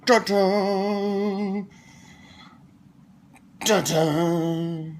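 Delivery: high loud ta-da fanfare. Pretend. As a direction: announce it like a trumpet. Fanfare presenting the toy.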